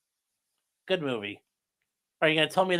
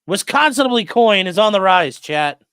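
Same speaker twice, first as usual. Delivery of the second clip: excited hype; 4 semitones higher, 10 dB louder than usual. Real, not acted. Streamer hypes chat donation.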